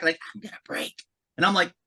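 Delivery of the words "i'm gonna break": hushed, voiceless whisper; whispering building. Acted. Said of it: "the building whispers back".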